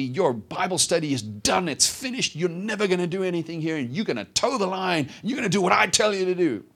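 high-pitched, emphatic imitated scolding; laying it on. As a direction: hammer out each decree as the tough master. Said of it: "he was just laying it on".

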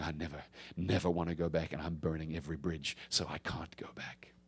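low, quiet vow fading toward a whisper; hushed vow. Retold or imitated. Preacher voicing true repentance to the congregation, hushed and low. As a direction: drop low and let the voice sink away.

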